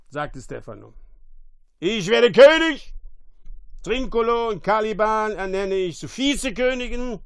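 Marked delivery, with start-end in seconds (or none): 1.8-7.2 loud, high declaiming; grand proclamation. A narrator's reading. Drunkard crowning himself king, loud proclamation. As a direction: announce it to the whole island.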